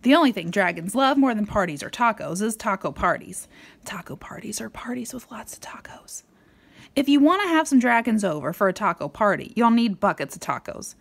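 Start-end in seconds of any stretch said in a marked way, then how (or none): 3.8-6.2 hushed storybook aside; whisper. A narrator's reading. Parent reading to child, whispered aside.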